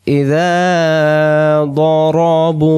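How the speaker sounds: drawn-out melodic reading; slow recitation. Acted. Teacher reciting for students. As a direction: chant it slowly with held vowels.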